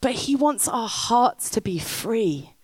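ordinary talk, nothing marked

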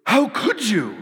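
high, loud shocked cry. Acted. Preacher mocking a shocked reaction, high and loud. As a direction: recoil in disbelief.

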